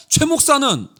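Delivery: lightly imitated boss grilling staff; interrogating senior pastor. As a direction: put them on the spot, mildly acted. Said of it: "목사들 한 명 한 명을 물어요".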